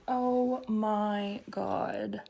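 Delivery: very slow, stretched wonder; drawn out. Real, not acted. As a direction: let it stretch in awe. Overwhelmed by apartment.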